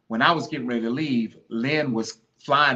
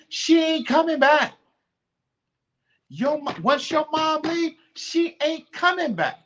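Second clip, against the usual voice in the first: voice breaking high; 13 semitones higher, 4 dB louder than usual. Real, not acted. Grief rising while speaking of mother.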